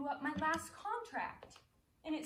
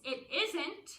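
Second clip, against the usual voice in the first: sharp denial; 5 semitones higher, 7 dB louder than usual. Acted. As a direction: snap it back, louder and higher. Actress denies in a quarrel.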